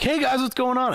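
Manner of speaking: put-on high, bubbly drunk customer greeting; drunk lady voice. Drunk customer greeting workers at the drive-thru, high and bubbly.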